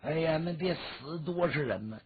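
ordinary delivery, nothing marked

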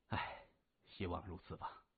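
a touch of weary resignation; resigned sigh. Acted. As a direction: let the hope sag out of the line. Gao Zhen sighs, resigned.

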